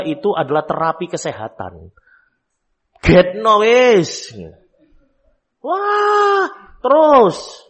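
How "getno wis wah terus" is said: mock wailing child. Mocking the crying child scene.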